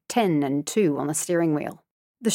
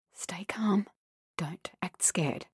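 much softer tense whisper; frightened whisper; 10 dB quieter, slower than usual. A narrator's reading. Girl hiding her fear, whispering to herself. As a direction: hiss it to yourself in fear.

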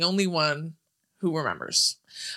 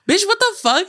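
imitating a stunned friend, much higher and louder; shocked outburst; 12 semitones higher, 4 dB louder than usual. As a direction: blurt it out in disbelief. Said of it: "And he goes".

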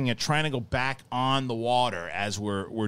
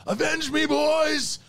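dying hero's hammy high shout; overacted death scene; 4 dB louder, much slower than usual. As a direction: scream the last words, over the top. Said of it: "did you get to my favorite part".

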